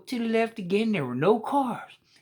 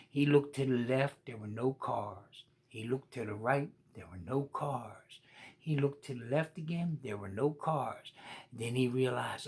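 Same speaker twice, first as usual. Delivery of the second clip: much softer, lower careful narration; hushed; 9 semitones lower, 10 dB quieter than usual. A narrator's reading. Narrator tells children quietly, softer and lower. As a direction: hush down as he checks each way.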